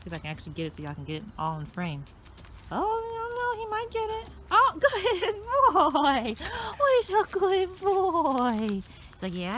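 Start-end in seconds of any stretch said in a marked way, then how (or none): 2.7-4.3 much higher excited squeal. Real, not acted. Dog nearly catches it. 4.5-8.8 much higher, louder, slow dog praise; squeaky praising a dog. Real, not acted.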